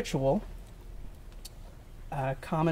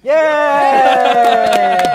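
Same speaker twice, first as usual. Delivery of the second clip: cheering; 9 semitones higher, 10 dB louder than usual. Real, not acted. Cheering the bride on.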